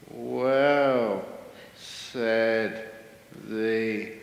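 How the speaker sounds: word by word with long pauses; deadpan, painfully slow storytelling; ultra-slow talker. Acted. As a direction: stretch each word and wait. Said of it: "who can't even talk fast".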